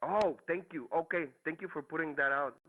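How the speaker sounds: ordinary talk, nothing marked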